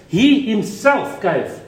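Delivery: "he himself gave" slowed, each word pressed down; emphatic. Shown as a demonstration. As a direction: lean on "he himself gave" slowly. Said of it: "It's emphatic".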